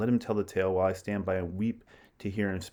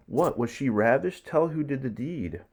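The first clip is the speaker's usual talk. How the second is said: raised, urgent demand; shocked stage question; 5 semitones higher than usual. Acted. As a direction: demand an answer in alarm. Shocked question read aloud.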